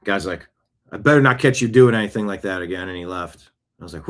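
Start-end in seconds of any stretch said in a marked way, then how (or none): 0.9-2.9 loud, quick warning; retold bouncer warning. Bouncer warning the kid before leaving, a loud, quick threat. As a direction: lay down the law fast.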